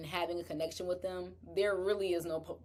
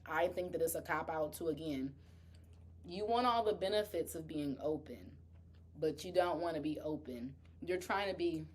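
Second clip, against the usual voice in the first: usual speaking voice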